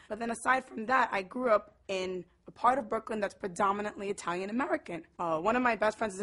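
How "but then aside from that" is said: usual speaking voice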